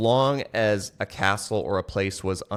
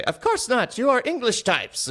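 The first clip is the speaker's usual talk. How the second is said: high, mocking French taunter accent; 3 dB louder than usual. French guard taunting the English knights, mock French accent. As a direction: sneer it in a silly French accent. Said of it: "Can't you tell by my accent?".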